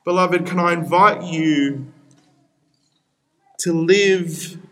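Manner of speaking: ordinary talk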